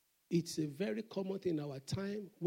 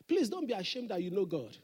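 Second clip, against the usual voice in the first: ordinary delivery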